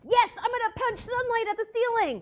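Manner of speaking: high, loud pirate character voice. Acted. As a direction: shout it as a squeaky-high pirate. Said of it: "I'm no longer talking like a pirate".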